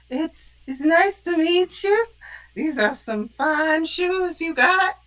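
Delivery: sly flattering Cora. Acted. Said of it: "Cora's gonna walk up and be like".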